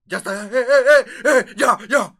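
high, very loud burst of shouts; sudden yelling to break up a fight; mock crowd shouting. Friend imitating the crowd that broke the silence, shouting.

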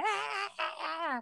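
high, soft imitation sob; a small high-pitched fake bawl; mock crying wail. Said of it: "we're all emotional and crying".

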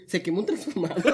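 ordinary delivery

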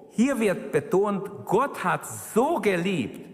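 "so" slowed and lifted; stress on so. Shown as a demonstration. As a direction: press the word "so" in "Gott hat so geliebt". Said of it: "Also so wird betont". ("so" langsam und betont)

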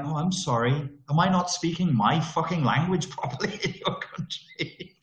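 sarcastic sorry with a laugh; sarcastic laughing apology. Retold or imitated. Tourist sarcastically apologising to locals, laughing. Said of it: "And I'm like".

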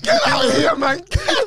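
high laughing get out of here; laughing dismissal. Real, not acted. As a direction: laugh it off in disbelief.

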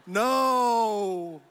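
high, drawn-out mock scream; long high "no", falling away; imitated crowd scream. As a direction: wail it like a horrified crowd. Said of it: "They were screaming".

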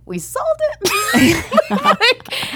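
high, slow, laughing question; can't believe it, laughing; stunned laughing disbelief. Retold or imitated. Disbelief at hearing they sold.

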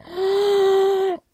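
loud high gasp of shock. Retold or imitated. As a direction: gasp in disbelief.

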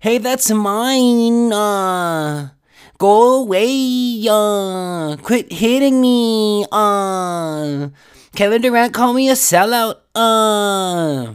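put-on high, loud, slow bratty kid whine; squabbling children. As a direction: drag each word out like a bickering toddler. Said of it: "fucking children are fighting".

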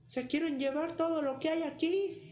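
put-on high, slow, piercing shout from a tiny boy; shouting with all his might. A narrator's reading. Pulgarcito bellows from inside the priest's house, high shout. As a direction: yell it so the whole house hears. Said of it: "gritó con todas sus fuerzas".

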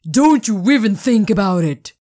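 loud, threatening shout. Acted. Owner chasing Spirit, shouting a warning. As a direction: yell a warning after her. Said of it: "he shouted".

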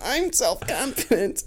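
high, slow mocking impression of Tanner. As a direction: squeak it out like teasing a friend. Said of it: "Tanner's like".

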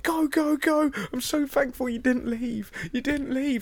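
very high, breathless gushing thanks; frantic relief. Retold or imitated. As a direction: blurt out your relief to the driver. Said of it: "dived into the back of the taxi and was like".

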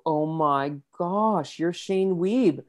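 high, slow starstruck fan squeal with a laugh; gushing fan. Retold or imitated. Fan on the street recognizing the singer, gushing slowly and high. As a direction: play the awestruck fan who just spotted a celebrity. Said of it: "Then they'd look at me and recognize me and go".

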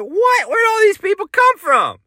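high-pitched squawk of astonishment; hammed-up shock; shocked disbelief. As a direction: shoot way up in pitch, baffled. Retelling his shock at followers.

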